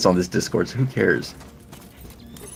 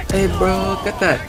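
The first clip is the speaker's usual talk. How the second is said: imitating a slang kid; 11 semitones higher, 3 dB louder than usual. Mocking a kid overheard at a restaurant, high hyped voice. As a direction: ham up a teenager bragging about rizz. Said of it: "heard a kid fucking talk".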